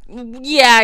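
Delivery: loud, drawn-out dismissive yeah. Retold or imitated. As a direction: brush her off. Cop brushing her off.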